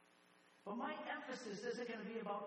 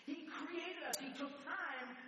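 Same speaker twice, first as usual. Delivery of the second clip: preaching at full force; 9 semitones higher than usual. Acted. Pastor preaching creation to the congregation, fervent high preaching. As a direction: build the fire as God creates man.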